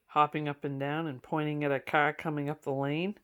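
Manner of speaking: ordinary delivery, nothing marked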